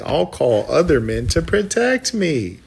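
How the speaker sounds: mock weak man. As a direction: whine it like a coward begging for help. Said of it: "Imagine being a grown man saying".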